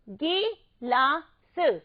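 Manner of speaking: गिलास very slow, split as गि, ला, स; syllable by syllable. Shown as a demonstration. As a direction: sound out गिलास in separate pieces. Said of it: "इसका उच्चारण कैसे करेंगे".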